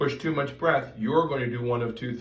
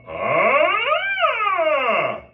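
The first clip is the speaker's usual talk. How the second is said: very high, loud focused ah; thin fast stream of air; much higher, louder focused squeak tone; laser beam of air; 9 dB louder than usual. Shown as a demonstration. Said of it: "that's a laser beam of air".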